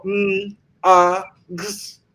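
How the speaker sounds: letter sounds demo. Shown as a demonstration. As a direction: break it into single sounds "mm, a, gs". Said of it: "yo no digo".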